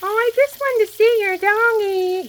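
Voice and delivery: mocking the lady's defensive sweet voice. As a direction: sneer her sugary excuse back at her. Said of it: "she got defensive".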